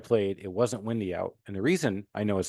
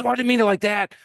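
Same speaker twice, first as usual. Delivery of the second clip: high, louder caddy protest; caddy's protest; 13 semitones higher, 7 dB louder than usual. Retold or imitated. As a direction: squeak it out like the flustered young caddy. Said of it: "He goes".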